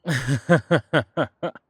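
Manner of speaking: a big, open burst of laughter; loud laugh. Real, not acted. Hearty laugh at a joke.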